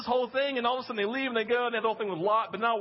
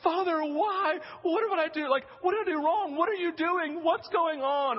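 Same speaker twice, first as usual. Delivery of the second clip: high, distressed questions; pleading son; 7 semitones higher than usual. Retold or imitated. Isaac tied to the altar asking his father, high distressed pleading. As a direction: act a bewildered child begging for answers. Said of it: "Isaac is just going".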